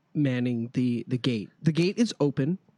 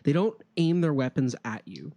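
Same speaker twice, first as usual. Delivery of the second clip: normal talking voice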